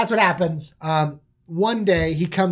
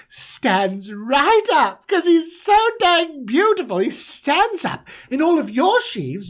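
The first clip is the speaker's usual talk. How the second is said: slow, high-pitched bragging; boastful dreamer; 8 semitones higher, slower than usual. Acted. Manager tells brothers of his beautiful sheave, high and boastful.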